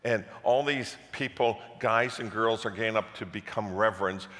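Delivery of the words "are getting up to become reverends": usual speaking voice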